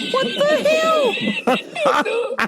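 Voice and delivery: high, slow, stretched what the hell through a laugh; shocked laughing shout. Real, not acted. Reacting to the weed prompt, shocked and laughing. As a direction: let the shock burst out, laughing.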